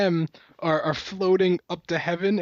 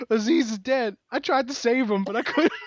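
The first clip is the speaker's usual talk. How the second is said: higher-pitched imitation of a movie character; retold mock lament; 6 semitones higher than usual. Friend quoting a movie character's excuse, in a raised voice. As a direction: push the pitch up, play-acting the excuse. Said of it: "So then he's like".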